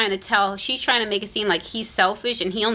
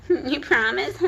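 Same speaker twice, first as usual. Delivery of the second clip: higher, mocking little-girl plea; creepy mocking voice; 4 semitones higher than usual. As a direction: repeat it as a creepy whiny plea. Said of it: "How creepy".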